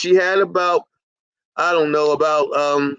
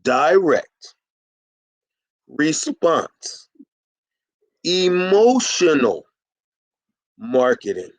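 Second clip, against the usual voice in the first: Direct, response, emotional, marketing, each word set apart with long pauses; deliberate slowed-down delivery with a pause after every word; 2 semitones lower, slower than usual. Shown as a demonstration. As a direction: spell it out slowly, word by word, for a slow listener. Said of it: "let me slow it down for you".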